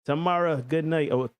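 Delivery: normal talking voice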